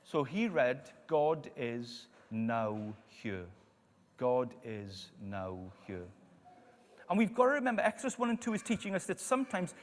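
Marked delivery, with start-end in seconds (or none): 1.1-6.1 slow, low, word-by-word reading; halting reading. Retold or imitated. Young grandson reads the sign above the bed, halting and slow. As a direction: read it out haltingly, pausing between words. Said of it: "couldn't quite make out the second word".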